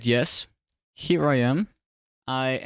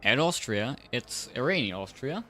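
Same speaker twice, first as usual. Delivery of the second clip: race commentary; 2 semitones higher than usual. Acted. Live race play-by-play.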